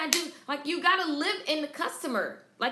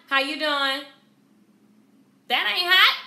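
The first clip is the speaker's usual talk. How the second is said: flat greeting mocked; 8 dB louder than usual. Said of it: "and not".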